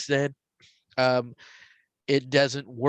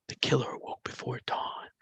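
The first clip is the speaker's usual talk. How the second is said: soft unvoiced whisper; 8 dB quieter than usual. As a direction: breathe it out like a ghostly voice on the CD. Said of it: "There's a whisper and then Jim".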